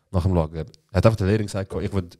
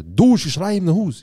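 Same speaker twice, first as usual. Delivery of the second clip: much higher, louder and slow, mock furious dad; slow, raised imitation of a shouting father; quoting angry father; 8 semitones higher, 5 dB louder, slower than usual. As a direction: play the father yelling down the phone.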